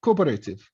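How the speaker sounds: cooperative as "ko-beh-RAY-tiv"; learner's pronunciation. Shown as a demonstration. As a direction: read cooperative as spelt, with a RAY syllable. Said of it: "هاي عادة إحنا بنقرأها". (نطق cooperative "كوبريتيف" كما تُكتب)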